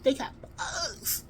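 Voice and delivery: high, slow, squeaky whisper; strained whisper. Retold or imitated. Child keeping girl outside from hearing, strained whisper. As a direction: hold back a scream while whispering. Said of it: "'Cause I'm trying to whisper. I'm trying to keep from screaming it out".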